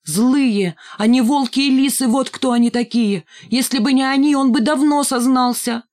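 high, loud furious rant; girl shouting at her grandfather about cruel classmates; angry outburst. Acted. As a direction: let the anger spill over.

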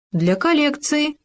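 high, innocent answer; innocently. A narrator's reading. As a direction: play the innocent, all wide-eyed. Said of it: "невинно ответил Хома".

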